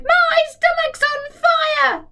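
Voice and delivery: put-on very high screeching old woman; shouted. Grandma screams for the fire brigade, high screech. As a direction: screech it in panic.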